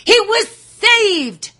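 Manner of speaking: high, slow, loud cry of relief; excited relief. A narrator's reading. Narrator telling listeners the hero is rescued, exultant. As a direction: let the joy burst out, slowly.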